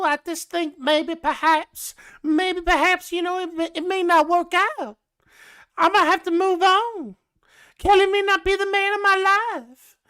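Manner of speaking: prim southern lady voice, way up high; southern belle. Retold or imitated. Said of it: "her southern accents".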